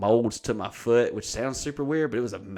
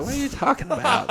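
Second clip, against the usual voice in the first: much higher, squeaky mock disbelief; incredulous. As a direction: throw it up high in disbelief. Incredulous reply to haters.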